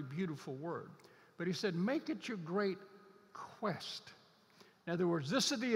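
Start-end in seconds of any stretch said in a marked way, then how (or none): 1.8-4.1 "quest" dropped to a near whisper after a pause; stress on quest. Shown as a demonstration. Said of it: "that word quest struck me".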